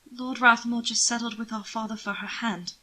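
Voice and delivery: usual speaking voice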